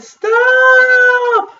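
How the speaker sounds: high, loud, long-held yell; yell at full volume. A narrator's reading. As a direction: yell it as loudly as you can. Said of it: "yelled as loudly as she could".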